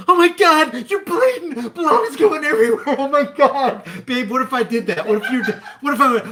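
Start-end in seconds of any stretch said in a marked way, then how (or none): 0.1-3.8 high, loud, frantic freak-out over the blood; hammed-up panic, voice shooting up; mock panic. Husband showing how not to react to a bleeding wife, loud and high mock panic. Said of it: "The last thing you wanna do is go".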